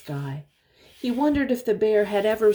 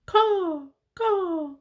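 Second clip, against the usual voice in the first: high cawing crow call; high, sharp crow cawing for a grandchild; 11 semitones higher than usual. Retold or imitated. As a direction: caw it out like a crow. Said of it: "what crows say".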